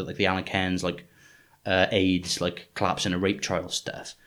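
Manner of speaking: ordinary talk, nothing marked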